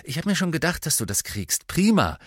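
high, excited call with a loud burst at the end; calling over. A narrator's reading. Katie calls congratulations to the new captain, calling over. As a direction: call across the room in delight. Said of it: "rief sie herüber".

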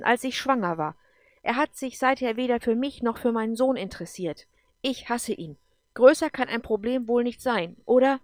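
loud, emphatic, sharp retort. A narrator's reading. Eva tells Gordon about the man who left her, sharp. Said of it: "Ihr Ton war scharf".

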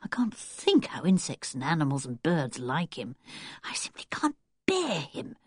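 soft, low, half-whispered thought; private resentful musing. A narrator's reading. Anne alone puzzling over Wilfred and creatures, hushed resentment. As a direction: grumble inwardly, keeping it hushed.

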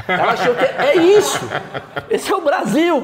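laughing through the words. Real, not acted. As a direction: chuckle while telling the punchline. Laughing at own joke.

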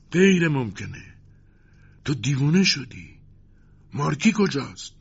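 high, loud, stunned outburst; shocked disbelief. A narrator's reading. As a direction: react as if it cannot be true. Commander stunned that the prisoner is freed. (با حیرت و ناباوری)

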